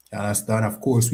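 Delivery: ordinary talk, nothing marked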